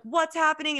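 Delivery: high, bright surprised question; lightly acted delight at seeing her; excited greeting. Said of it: "They were like".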